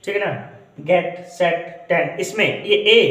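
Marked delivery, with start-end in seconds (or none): 0.8-1.9 गेट, सेट said very slowly so the ए stands out; slow vowel demo. Shown as a demonstration. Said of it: "ए की मात्रा आ रही है". (गेट, सेट बहुत धीरे, ए की मात्रा साफ़)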